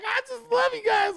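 mock gushing in a high squeal; exaggerated, high-pitched cutesy adoration; fawning old lady. Host mocking a woman fawning over morning show hosts, gushing falsetto. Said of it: "she's just fawning over people".

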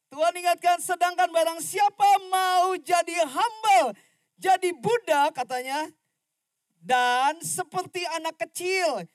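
high, loud fiery sermon voice; full-force preaching. Acted. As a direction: preach it out to the whole congregation. (khotbah berapi-api dengan suara keras dan tinggi)

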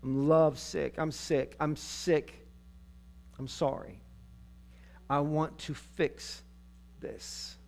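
soft, halting acted regret; trailing off almost to nothing; subdued apology. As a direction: sink into a quiet, remorseful plea. Acting the regretful wife's apology.

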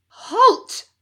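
sharp high loud command; shout. A narrator's reading. Stranger bursting into warehouse, high loud shout. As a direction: stop them dead with one cry. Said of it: "shouted an unfamiliar voice".

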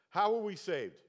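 called out to the crowd. Shown as a demonstration. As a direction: prompt them to answer together. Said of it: "Let's say it again".

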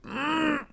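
soft, high-pitched held-in whimper; imitated choked-up whimper. Retelling girls walking down the aisle, mock choked-up whimper. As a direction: mimic holding back tears with a small squeak. Imitating himself choking up at wedding.

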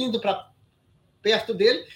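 ordinary talk

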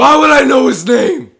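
why on earth would I know that; loud, high indignant protest to his friend; incredulous outburst. Real, not acted. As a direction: shout it, high and loud, in disbelief.

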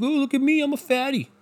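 high-pitched mocking impersonation. Mimicking a man drawing attention to his weight, mocking.

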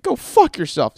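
loud, high mock outburst; angry retort. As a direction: blow up at them, acted. Acted retort at audition.

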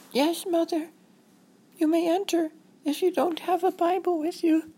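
put-on very high, small child voice; high-pitched prim kid; wary little girl. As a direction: answer as a guarded girl in hiding. Voices Robin behind her door.

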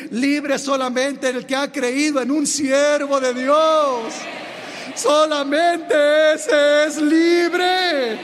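slow, building to a loud, high proclamation; preaching. Acted. Preacher to congregation, slow rising proclamation. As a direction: hammer each word home.

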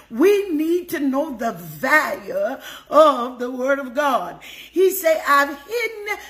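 fervent, emphatic sermon delivery with lifted pitch peaks; preaching. Real, not acted. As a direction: exhort the congregation, punching key words. Preaches and exhorts the congregation.

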